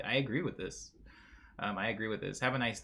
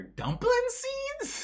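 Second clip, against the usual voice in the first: very high, slow squeak; squeaky quote; much slower than usual. Retold or imitated. Reading a chatter's message in a squeaky high voice. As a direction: squeak it out in disbelief. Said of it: "Says Kaiju".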